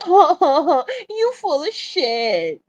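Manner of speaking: a hint of his teasing drawl; imitating Bronsberg. Imitating a friend teasing the women on the panel, slow mock drawl. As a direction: lightly mimic a friend's playful jab. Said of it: "He says it just like that".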